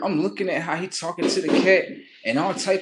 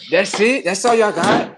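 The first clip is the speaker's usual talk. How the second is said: loud, raised challenge; annoyed taunt; 4 semitones higher, 7 dB louder than usual. Real, not acted. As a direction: scoff loudly at the noisemakers. Scoffing at neighbours' fireworks noise.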